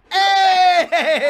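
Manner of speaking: loud, high hype yell; Wild 'N Out hype. Acted. Friend doing Wild 'N Out audition, shouting hype. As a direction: throw a hype shout like a TV host. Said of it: "It's his impression of a".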